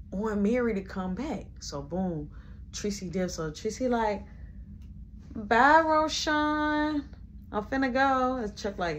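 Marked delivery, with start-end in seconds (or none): 5.3-8.4 high, drawn-out sweet farewell; sugary fake goodbye. Tracy leaving with Chuck, drawn-out syrupy goodbye. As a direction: stretch the bye out all fake-sweet.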